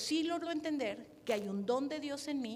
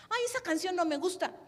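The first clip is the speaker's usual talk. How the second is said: whiny complaint; 6 semitones higher, 5 dB louder than usual. Retold or imitated. Imita a un servidor quejumbroso.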